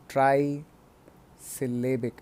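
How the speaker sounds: ट्राइसिलेबिक said slowly, with a gap after ट्राइ; broken into parts. Shown as a demonstration. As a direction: stretch ट्राइसिलेबिक out, splitting it into chunks. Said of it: "तोड़कर". (ट्राइसिलेबिक को तोड़कर, धीरे-धीरे)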